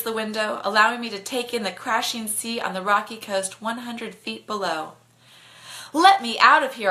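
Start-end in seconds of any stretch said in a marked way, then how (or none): 5.9-6.9 loud demand. Acted. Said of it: "I say".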